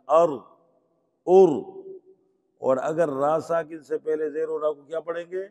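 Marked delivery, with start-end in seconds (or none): 0.1-0.3 ار as "ar" with a thick, full r; short a, then heavy still r in ار. Shown as a demonstration. Teacher shows heavy r after zabar, full sound. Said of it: "را کو موٹا پڑھیں گے". 1.3-1.5 ار as "ur" with a thick, full r; short u, then heavy still r in ار. Shown as a demonstration. Teacher shows heavy r after pesh, full sound. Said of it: "را کو موٹا پڑھیں گے".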